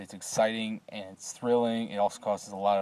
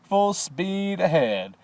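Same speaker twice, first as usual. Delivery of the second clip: high, slow, sing-song command; eccentric Wonka; 8 semitones higher, 6 dB louder, much slower than usual. A narrator's reading. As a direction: stretch it out like a mad captain. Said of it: "the inflections of Willy Wonka".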